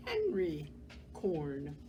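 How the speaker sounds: ordinary delivery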